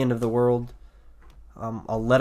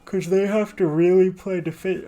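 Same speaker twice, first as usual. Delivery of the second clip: talking through a big yawn; words stretched by a yawn; 7 semitones higher than usual. Real, not acted. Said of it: "I'm yawning a lot".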